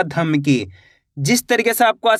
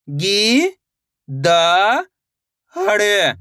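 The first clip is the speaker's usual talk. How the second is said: isolated, held sounds with long pauses; teacher slowly spelling out by sound; syllable by syllable; much slower than usual. Shown as a demonstration. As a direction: sound out each syllable separately for learners. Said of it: "एक अक्षर का उच्चारण करके".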